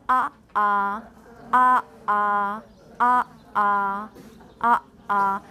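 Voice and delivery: uh, uh with the throat shut sharply between them; two level vowels, uh, uh, split by a catch; glottal stop. Shown as a demonstration. Teacher drills two vowels in a row, glottal catch between. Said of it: "there is actually usually a glottal stop".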